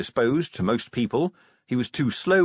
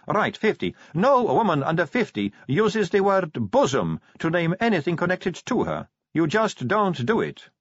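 Sally, lecturing; 7 semitones higher than usual. Acted.

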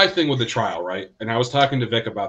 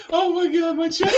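high, breathless laughing words; laughing so hard it hurts; helpless laughter; slower than usual. Real, not acted.

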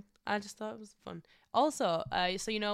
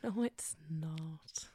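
breathy whispered denial; whispering; 4 dB quieter, much slower than usual. Real, not acted. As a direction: whisper it as a cheeky aside. Teasing aside while partner talks.

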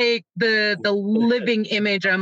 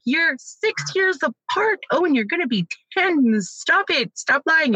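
raised-pitch mock scolding; scolding mom; 7 semitones higher than usual. Mother scolding her bickering kids, higher mock scold. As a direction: imitate yourself telling the kids off.

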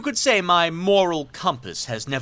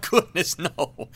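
laughing; 6 semitones higher, slower than usual. Acted. Laughs off needing therapist.

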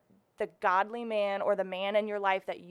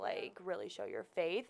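ordinary talk, nothing marked

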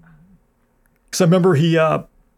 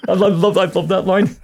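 normal talking voice

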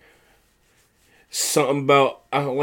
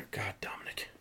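whispered, much softer; exasperated whisper; 6 semitones lower, 23 dB quieter than usual. Real, not acted. Host groaning about the heel Dominic, exasperated whisper. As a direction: breathe his name in fed-up disgust.

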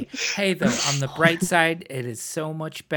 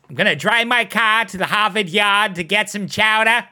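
loud, high Boston accent; hammed-up Boston accent, louder; New England accent; 5 semitones higher, 7 dB louder than usual. Shown as a demonstration. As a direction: drop the R's like a broad New Englander. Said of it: "Vastly different accent".

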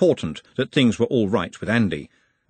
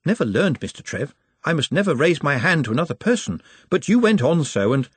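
high-pitched, apologetic underling; earnest, polite Nutt; 6 semitones higher than usual. Acted.

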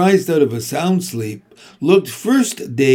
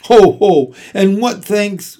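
loud, high mock laugh into complaint; scornful scoff; 5 semitones higher, 7 dB louder than usual. A narrator's reading. Templeton scoffs at being asked favours, loud and scornful. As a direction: scoff indignantly.

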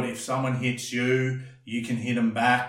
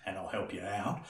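calm bouncer; 6 semitones lower, 6 dB quieter than usual. Retold or imitated. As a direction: lower and softer, calm and placating. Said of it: "or you go like".

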